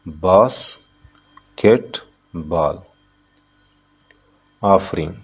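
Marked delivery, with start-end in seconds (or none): 0.1-2.8 "basketball" very slowly as बास, केट, बॉल with long pauses; syllable by syllable. Shown as a demonstration. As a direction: count out three separate beats in "basketball". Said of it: "basketball में कितने syllable है?". ("Basketball" को बास... केट... बॉल, रुक-रुक कर)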